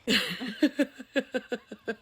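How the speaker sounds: high, helpless laughter; real giggling fit. Real, not acted. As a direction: crack up mid-attempt. Failing at the mystery sound.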